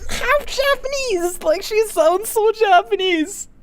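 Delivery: high, stretched, near-crying squeals of amazement; excited squeal. Real, not acted. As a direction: squeal with overwhelmed delight. Reacting to a fluent half-Japanese speaker.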